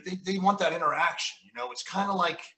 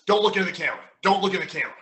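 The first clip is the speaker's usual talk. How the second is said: lightly voiced boss's order, higher and louder; Vince; 4 semitones higher, 6 dB louder, faster than usual. Boss instructing wrestlers on TV, imitated firm order. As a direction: imitate a boss laying down a rule. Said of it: "Vince would tell us".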